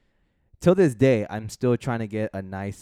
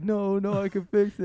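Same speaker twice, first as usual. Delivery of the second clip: desperate insistence; 12 semitones higher, 5 dB louder than usual. Retold or imitated. Desperate kid retold.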